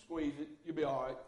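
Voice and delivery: a hint of a gruff dad shrugging off a scraped finger; mock dismissive dad. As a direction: brush it off like a dad who can't be bothered. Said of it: "that's the way men are".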